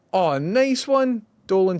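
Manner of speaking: high, slow pleased "oh"; delighted. Real, not acted. Streamer delighted by a viewer's gift, high and slow. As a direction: savour the good news.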